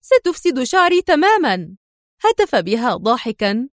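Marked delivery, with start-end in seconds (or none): 0.0-1.8 upset outburst under the sprinklers; angry cry. A narrator's reading. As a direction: shriek at the water ruining her hair. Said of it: "وفي غضب هتفت راشيل".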